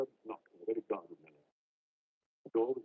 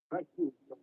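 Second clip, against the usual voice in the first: note of triumph; 9 semitones higher, 6 dB louder than usual. A narrator's reading. Butler answering the voice behind the door, with a note of triumph. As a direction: answer as if a point is proved. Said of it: "with a note of something like triumph".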